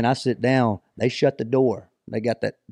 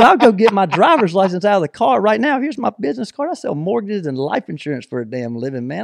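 retold indignant bluff; 8 semitones higher than usual. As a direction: talk your way out, starting high. Retold bluffing protest to accusers.

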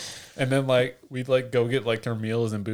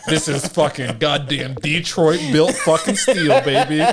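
mock boast; 4 semitones higher, 6 dB louder, slower than usual. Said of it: "And I was like".

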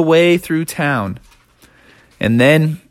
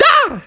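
very high startled scream; a quick frightened shriek; high alarmed yelp. A narrator's reading. Townsfolk fleeing the snowman, alarmed yelp.

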